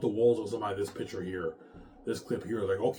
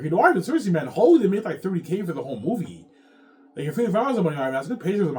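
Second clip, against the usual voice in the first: imitating Peter; 7 semitones higher, 6 dB louder, faster than usual. Ivan voicing Peter arguing.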